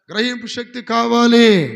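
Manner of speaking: full-voice shout of గ్రహింపు శక్తి కావాలి, slow; slow, booming call of గ్రహింపు శక్తి కావాలి; loud shout. Shown as a demonstration. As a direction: belt out కావాలి as a rallying cry. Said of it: "గట్టిగా చెప్పండి".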